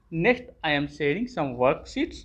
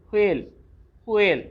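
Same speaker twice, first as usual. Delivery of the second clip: ordinary talk, nothing marked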